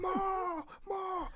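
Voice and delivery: very high, drawn-out falsetto call; tiny high party cheer; playful crowd call. Pretend. Playful crowd call after chant.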